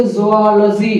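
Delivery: जूलॉजी as zoh-AH-luh-zee; correct pronunciation. Shown as a demonstration. Teacher gives students the right form, carefully pronounced. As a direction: open जूलॉजी with 'zoh-ah', never 'zoo'. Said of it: "इसको बोलेंगे".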